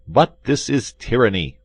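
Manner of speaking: exasperated gentleman protesting; exasperated cry. A narrator's reading. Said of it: "cried one of them".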